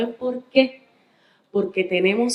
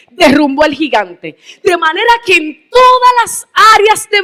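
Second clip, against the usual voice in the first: rising, louder pulpit voice, driving the point home; fervent preaching; 6 semitones higher, 7 dB louder than usual. Acted. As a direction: build to a full preacher's cry. Preaching builds in force. (predicación fervorosa)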